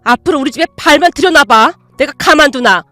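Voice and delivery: loud, furious tirade at the daughter-in-law; furious scolding. Acted. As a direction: tear into her, no holding back. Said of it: "소리치셨어요". (며느리에게 삿대질하며 크게 호통치는 엄마)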